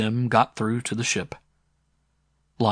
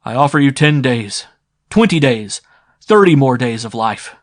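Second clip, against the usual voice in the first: loud; 3 semitones higher, 9 dB louder than usual. A narrator's reading. Sim bargains with crowd.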